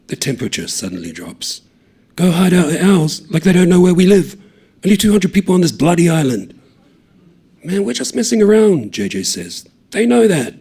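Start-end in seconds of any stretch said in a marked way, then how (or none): 2.1-6.5 loud, high, fast protest; heated argument. A narrator's reading. Tama arguing against hiding out, loud and heated. 7.6-10.5 heated reply. A narrator's reading. J J argues back.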